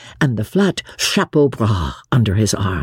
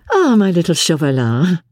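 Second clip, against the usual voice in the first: gaiety; 7 semitones higher than usual. A narrator's reading. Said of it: "with unconcerned gaiety".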